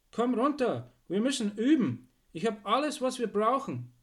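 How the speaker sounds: high, loud summons; calling out. Acted. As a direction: shout up to a friend, urging. Archibald calls up to Zipp.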